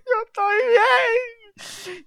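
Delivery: high, slow crying answer; terrified whimper. Retold or imitated. Terrified boyfriend answering the loud clown mother, high whimpering. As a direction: whimper it out near tears. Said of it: "Y yo".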